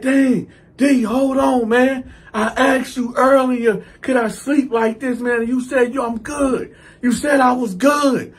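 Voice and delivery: high-pitched, wounded complaint; aggrieved protest. Retold or imitated. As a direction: imitate a cellmate pleading his case. Retold cellmate protesting.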